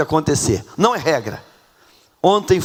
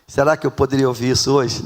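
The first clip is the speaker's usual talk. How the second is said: ordinary talk, nothing marked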